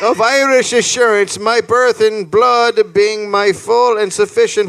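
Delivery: high, impassioned declamation; letter read aloud. Acted. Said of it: "this is the letter he read".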